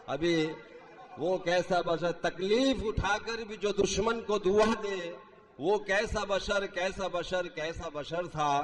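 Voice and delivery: declaimed Urdu verse; stately nazm recitation. Acted. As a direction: recite the couplet with grand feeling. Mushaira poetry recitation. (شاہانہ انداز میں نظم خوانی)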